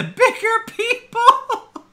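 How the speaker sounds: high, put-on voice with a laugh breaking in; mock self-righteous. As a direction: ham up the noble martyr. Mocking the complainer's self-righteousness.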